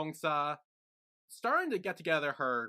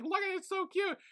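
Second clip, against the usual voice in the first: much higher, squealing over something cute; gushing squeal. Retold or imitated. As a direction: gush like a fangirl over a cute photo. Onsa gushing over picture.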